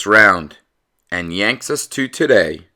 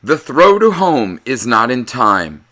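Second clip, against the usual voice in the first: ordinary delivery, nothing marked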